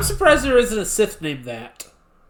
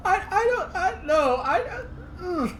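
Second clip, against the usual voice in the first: laughing helplessly; 12 semitones higher, 7 dB quieter than usual. Real, not acted.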